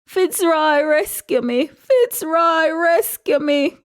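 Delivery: high drawn-out wailing plea; wailing, squealing. A narrator's reading. Fiona, being removed, calls Fitzroy to rescue her, wailing. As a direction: squeal it high, stretching the words. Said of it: "wailing and squealing".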